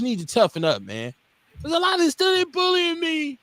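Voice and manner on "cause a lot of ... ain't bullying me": high-pitched, whiny kid imitation; put-on high complaining voice; mock whining. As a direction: mimic a complaining bullied child. Mimics bullied kids complaining.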